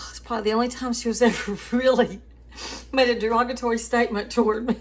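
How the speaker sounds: fast, laughing through the words; can barely get it out for laughing; laughing while talking. Real, not acted. Recalling mom's only insult, laughing.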